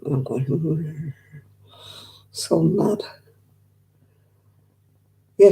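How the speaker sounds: usual speaking voice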